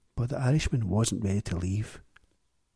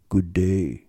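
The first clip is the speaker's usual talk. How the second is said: lightly lowered deep stranger's voice; 4 semitones lower, 4 dB louder than usual. Acted. Stranger greeting the shop, lightly deep voice. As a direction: say it from deep in the chest. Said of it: "voice was exceptionally deep".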